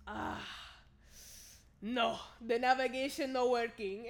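imitated Spanish-accented broken English; mock Spanish-accented excuse. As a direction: play the Spanish-accented driver, hesitating first. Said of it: "This is all in a Spanish accent".

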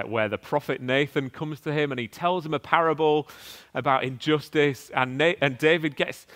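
ordinary talk

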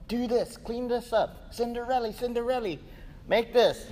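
high, ordering, mocking commands; bossy mistress. Sarah orders Hagar about, high and bossy. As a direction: boss the servant around. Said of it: "treat Hagar harshly".

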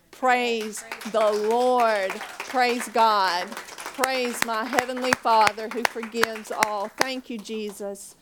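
slow, loud praise; preaching praise. Real, not acted. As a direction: lift your voice in praise. Sermon praise.

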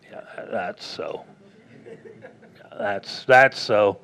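breathy low angel assuring you; a hushed, laughing mock angel. Angel confirms the doubter, mock hushed and low. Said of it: "an angel appeared and went".

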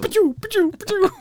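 high mock laser zaps; toy blaster sounds. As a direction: pachoo blasts, squeaky and high. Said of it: "toy robot blaster noises".

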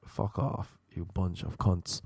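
usual speaking voice

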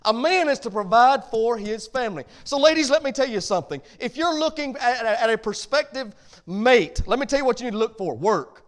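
ordinary talk

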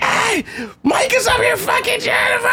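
high, yelling announcement; shouted tattle. Retold or imitated. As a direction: call it out to the whole house. Friend yelling discovery.

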